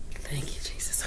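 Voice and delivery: breathy whispered thanks; whispering. Real, not acted. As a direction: whisper it to yourself. Whispered thanks after grace.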